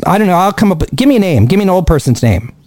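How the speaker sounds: ordinary talk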